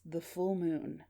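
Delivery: slow, soft mysterious caller; faint, measured phone voice; weak, unclear voice. Acted. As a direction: let each word out slowly and faintly. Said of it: "This part is weak and unclear".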